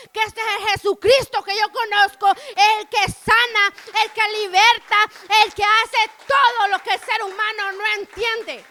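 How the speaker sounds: loud, rising preacher's exhortation; full-force preaching. Acted. Preacher urging the congregation to thank God, at full force. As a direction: pour it out to the congregation.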